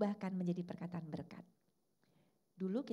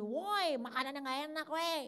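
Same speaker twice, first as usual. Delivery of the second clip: higher, louder mock yell at the wife; a husband barking at bad cooking; imitating a rude husband complaining at dinner; rude complaint; 8 semitones higher, 5 dB louder than usual. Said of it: "ya jangan cuma gini".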